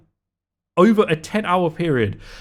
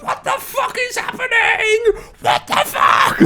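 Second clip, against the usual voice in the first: very high strained shouting; high, cracking mock yell; mock panicked shout. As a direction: yell like someone sick hearing explosions. Imitating panicked people hearing chaos.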